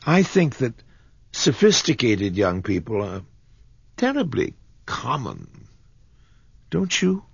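put-on light, high young girl, teasing; girlish Kismine voice. A narrator's reading. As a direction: play the innocent girl fishing for agreement. Narrator voicing the girl Kismine.

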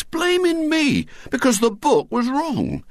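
high-pitched indignant complaint; wounded schoolboy outrage; aggrieved grumbling boy. A narrator's reading. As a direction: grumble in protest at unfair blame. Boy grumbling aloud over blame.

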